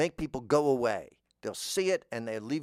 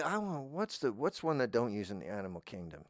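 ordinary talk

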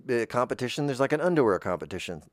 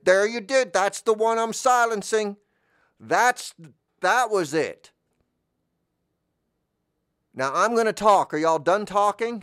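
Bolubus; 10 semitones higher, 6 dB louder than usual. Acted. Planet god scolding intruders in his holy space, booming. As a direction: proclaim it like an offended deity. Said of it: "said the voice from the altar".

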